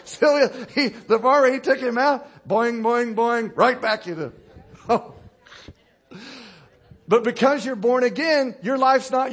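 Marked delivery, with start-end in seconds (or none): 2.5-3.6 high, loud boing through laughter; laughing boing. Retold or imitated. Preacher retells the sheep jumping back, laughing boing. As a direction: bounce it while laughing. Sheep bouncing imitated again.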